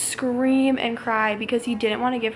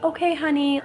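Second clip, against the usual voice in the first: meek pushover; 5 semitones higher than usual. Retold or imitated. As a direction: play it like a doormat. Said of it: "I'm not gonna sit there and be like".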